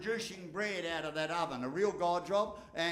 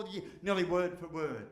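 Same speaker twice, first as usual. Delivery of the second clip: ordinary talk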